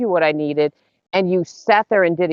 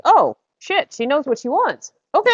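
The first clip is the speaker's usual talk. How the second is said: mock surprise; 10 semitones higher than usual. Imitating friend's surprised thought.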